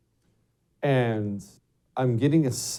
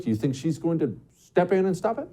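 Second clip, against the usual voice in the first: ordinary delivery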